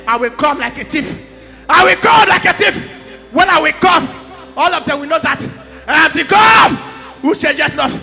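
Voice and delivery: fervent prophetic preaching as the voice of God. Acted. Prophet voicing God to Amos, preaching fervently. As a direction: declare it like a prophet bringing God's word.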